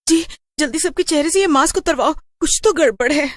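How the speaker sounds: high, urgent alarmed plea; panicked wife begging him to act. Acted. Wife panicking as the masked kids act strange, high and urgent.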